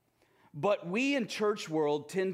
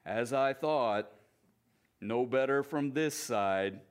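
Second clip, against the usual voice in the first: gloomy Eeyore; 4 semitones lower than usual. Acted. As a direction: drag it out like nothing matters. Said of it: "looked at himself again in the water and said".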